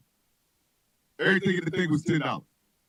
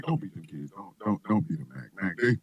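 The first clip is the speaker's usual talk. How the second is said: imitating mother; 10 semitones lower than usual. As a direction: lightly mimic a pleading mother. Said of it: "She's like".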